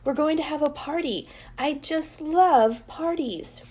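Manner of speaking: put-on gushing little girl. A narrator's reading. Chloe telling class about parties, high gushing voice. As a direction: swoon over the word love.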